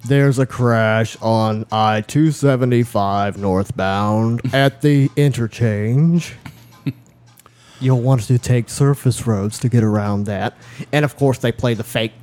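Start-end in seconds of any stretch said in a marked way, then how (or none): 0.1-10.6 slow, drawn-out mock traffic report; mock traffic reporter. Radio reporter warning drivers of a crash, traffic-report voice. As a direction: read it like a radio traffic bulletin.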